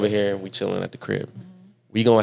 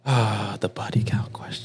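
whisper; 7 dB quieter, slower than usual. Acted. Dreaded topic comes up.

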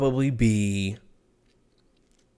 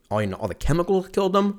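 normal talking voice

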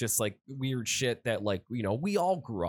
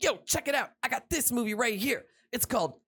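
high-pitched cartoon buddy voice; eager raccoon; 10 semitones higher, faster than usual. Raccoon shows his friend a tape, eager high voice. Voicing raccoon showing off movie.

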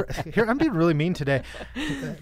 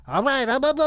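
higher, mock helium squeak; fake helium voice; 9 semitones higher than usual. Host mimicking an actor's helium bit, fake helium voice. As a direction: imitate someone badly faking a helium voice. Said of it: "he does that fake helium voice".

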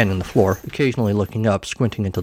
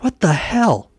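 shocked; 9 semitones higher, slower than usual. Acted. Worms spill out.

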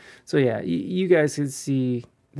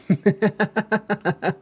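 high chuckling run of laughter; real laugh; 6 semitones higher than usual. Real, not acted. Storyteller amused by girlfriend's displeasure, real laugh. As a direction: laugh helplessly at your own story.

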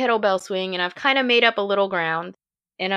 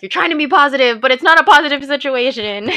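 higher, louder, a different voice for the doubter; the nagging inner voice arguing back, louder and higher; scolding inner voice; 6 semitones higher, 6 dB louder, faster than usual. Retold or imitated. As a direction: let the inner critic snap back. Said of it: "voices in my head that were fighting".

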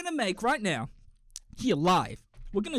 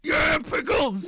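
slow, loud, higher cheer; excited shout; 9 semitones higher, 8 dB louder, much slower than usual. Real, not acted. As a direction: belt it out like a chant for pickles. Cheering for pickles together.

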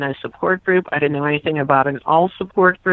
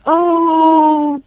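a high, louder sheepish "Oh,"; retold surprised oh; 10 semitones higher, 5 dB louder than usual. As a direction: catch yourself out, sheepish surprise. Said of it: "I said".

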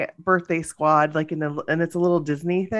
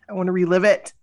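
ordinary talk

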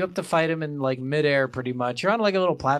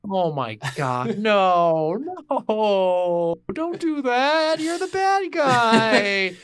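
high, loud, drawn-out lament; mock-anguished wail; 7 semitones higher, 5 dB louder, much slower than usual. Protesting the villain's sad death, hammed-up anguished wail. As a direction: wail it slowly, rising much higher. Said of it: "where I got so sad".

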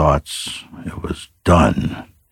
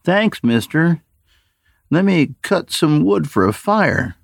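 put-on much higher, youthful boy's voice, friendly and ingratiating; eager teenage boy; 13 semitones higher than usual. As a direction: play the kid sweet-talking the old man.

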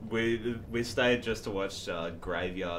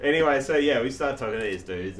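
usual speaking voice

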